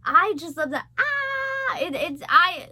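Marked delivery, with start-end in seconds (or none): 1.0-1.8 sudden high squeal of delight. Real, not acted.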